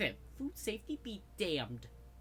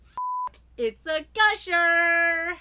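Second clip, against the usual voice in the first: slow booming exclamation; loud drawn-out yell; 7 semitones higher, 13 dB louder, much slower than usual. Acted. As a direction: bellow it out, stretched. Mock alarm at the bleeding.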